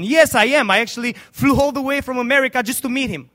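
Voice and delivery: loud, high and eager; eager preached quote. Acted. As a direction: proclaim it with fervour. Evangelist answers eagerly.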